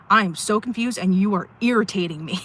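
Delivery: loud, emphatic retold blurt; exasperated outburst. Recounting a confrontation with a confusing person, exasperated outburst. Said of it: "blurted it out".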